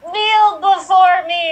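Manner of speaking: slow commanding villain demand; loud, slow imperial order; General Zod. Acted. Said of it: "General Zod".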